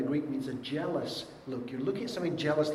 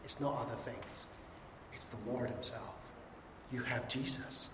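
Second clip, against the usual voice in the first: hushed reassurance; 4 semitones lower, 13 dB quieter than usual. Real, not acted. Preacher drops to an intimate hush.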